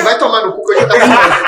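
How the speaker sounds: laughing yell; laughing mock shout. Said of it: "eu falei assim".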